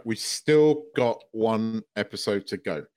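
measured, deliberate repeat of the point; slow emphatic reminder. Real, not acted.

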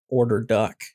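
usual speaking voice

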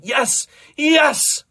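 loud, high, rising yeses; crying out. A narrator's reading. Doctor Rex reaching climax alongside them, crying out. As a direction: cry out in acted ecstasy. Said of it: "Doctor Rex cried".

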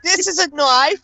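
high, laughing Australian knife line; a half-laughed Crocodile Dundee quote; mock Australian accent. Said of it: "That's two accents".

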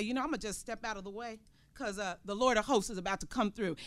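hushed, casual voice of a believer; quiet aside. Acted. Believer stepping aside for the Lord, hushed and casual. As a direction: murmur it low and easy, stepping aside.

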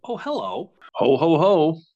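loud Santa ho ho ho; jolly ho ho ho. Pretend. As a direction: belly laugh like Santa. Christmas Santa greeting.

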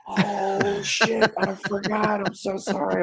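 gasp, then high-pitched mock apology; replayed "oh no" moment, pitched high; retold panicked realisation. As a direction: re-enact suddenly remembering, voice jumping up.

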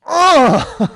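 loud comic grunt of impact; pained grunt. Pretend. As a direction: grunt like you're hit by a car. Hit again in story.

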